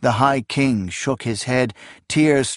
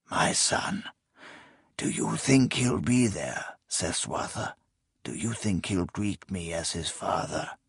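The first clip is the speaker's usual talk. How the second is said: weak dying voice; 4 semitones lower, 9 dB quieter than usual. Acted. Dying king asks about son.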